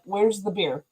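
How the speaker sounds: a light Australian accent, imitating the coworker. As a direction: say it like a laid-back Aussie. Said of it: "Australian accent".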